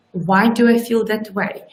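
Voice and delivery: ordinary talk, nothing marked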